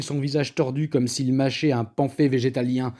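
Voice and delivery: ordinary delivery, nothing marked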